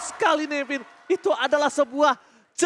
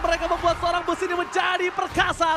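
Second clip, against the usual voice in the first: rising hype play-by-play, higher, louder; esports caster; 6 semitones higher, 3 dB louder than usual. Acted. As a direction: call the teamfight with building excitement. Caster calls a teamfight live.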